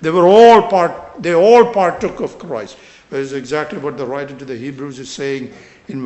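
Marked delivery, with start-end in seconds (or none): 0.0-2.8 loud, emphatic ALL each time; stress on ALL. Shown as a demonstration. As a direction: hammer the word all. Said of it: "I stressed the word all".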